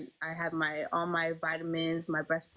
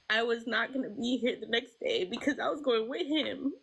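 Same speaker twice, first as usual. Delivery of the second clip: fighting back tears; 4 semitones higher than usual. Real, not acted. Recalling her baby's death.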